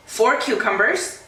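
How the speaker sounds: ordinary talk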